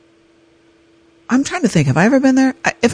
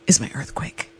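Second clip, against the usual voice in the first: hushed whisper; 3 semitones lower, 8 dB quieter than usual. Acted. Dramatic aside.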